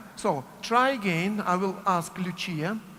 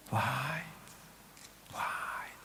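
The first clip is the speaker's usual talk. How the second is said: whispered light; 5 semitones lower, 9 dB quieter than usual. Shown as a demonstration.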